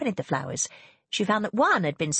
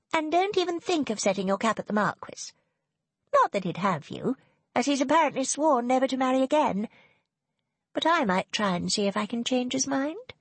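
higher-pitched snooty sister; Serena, haughty and spiteful; 8 semitones higher than usual. A narrator's reading. Sister claims the Marquis over breakfast, high and haughty. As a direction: needle a rival in a raised pitch.

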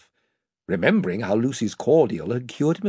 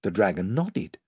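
ordinary delivery, nothing marked

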